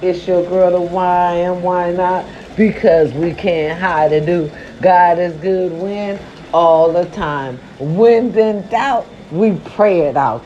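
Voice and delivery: belted signature intro, slow; loud catchphrase intro. Acted. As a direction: proclaim the catchphrase to the crowd.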